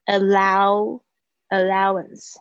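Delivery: "allowance" very slow, vowels pulled long and open; exaggerated vowel. Shown as a demonstration. Teacher drilling the vowel sounds of "allowance", very slow and open. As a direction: drag out "allow-, allowance" syllable by syllable. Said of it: "这个有这个A的声音是啊".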